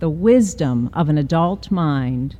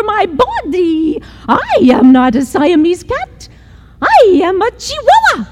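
put-on Spanish accent; 12 semitones higher than usual. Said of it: "using his very best Spanish accent".

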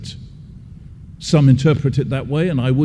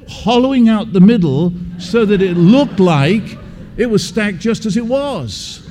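ordinary talk, nothing marked

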